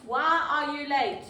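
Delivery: ordinary delivery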